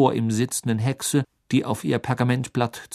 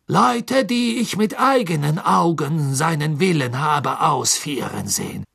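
hoch, gedehnt beteuernd; nervöser Karkaroff; 8 semitones higher, 6 dB louder than usual. Acted. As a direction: reuig bitten und beschwichtigen. (Karkaroff beteuert dem Gericht seine Reue)

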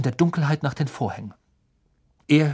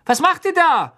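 called out high and loud; calling out; 13 semitones higher, 7 dB louder than usual. A narrator's reading. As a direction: shout it up the stairs. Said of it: "verschlafene Stimmen riefen ihnen nach".

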